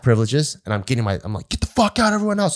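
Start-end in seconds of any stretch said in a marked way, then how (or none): 1.5-2.6 high, yelled orders to the squad; barking like a drill instructor. Retold or imitated. Squad leader to his squad, shouting orders high. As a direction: play the little drill instructor, yelling high. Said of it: "like a little drill instructor now".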